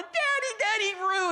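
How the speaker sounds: put-on high-pitched child's cry for Daddy; excited high kid voice; kids calling Dad. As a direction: imitate little kids yelling for their father. Small kids running, calling to father.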